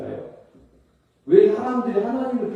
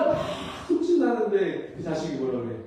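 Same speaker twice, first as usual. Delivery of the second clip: ordinary talk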